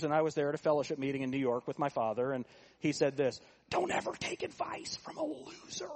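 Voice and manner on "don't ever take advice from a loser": much higher, softer put-on old preacher voice; John Rawlings impression. Old pastor preaching to preachers at a fellowship meeting, high soft imitation. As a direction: lift it way up and pull it back, mimicking a preacher he heard as a teen. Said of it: "he talked like this".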